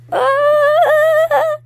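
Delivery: piercing high yelp at full volume; startled cartoon shriek; sharp scream. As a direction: let out a sudden shrieking scream. Toy character cries out.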